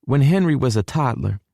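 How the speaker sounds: high motherly southern voice; high female character voice; southern mama reminiscing. A narrator's reading. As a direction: begin a family tale in a high Texan mom's voice. Mama starts story about son.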